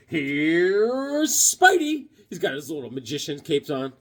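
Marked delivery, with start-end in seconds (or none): 0.1-2.1 drawn-out presentation. Real, not acted. Showing a comic cover.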